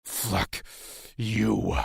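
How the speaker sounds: strained, spitting defiance; two words forced out separately; hissed through spittle. A narrator's reading. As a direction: hiss it with contempt. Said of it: "I hissed through spittle".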